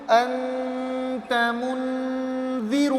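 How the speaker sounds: "انت منذی" stretched very slowly; slow blending. Shown as a demonstration. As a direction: sound out "انت منذی" slowly, every syllable drawn. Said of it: "اب آخری word کیا ہے".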